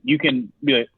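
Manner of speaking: ordinary talk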